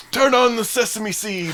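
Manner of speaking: put-on high, accented old man voice; doddering grandpa giving orders; old Yiddish grandpa. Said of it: "they would say".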